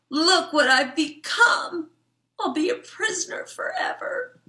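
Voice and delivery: hammed-up self-pity; despairing fly. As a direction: pour out woe like a tragic little fly. Fly laments in vacuum.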